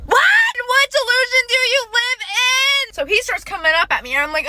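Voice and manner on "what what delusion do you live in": high, slow disbelieving cry; exasperated at how deluded he is; incredulous outburst. Real, not acted. Telling viewers about the date's claim, incredulous.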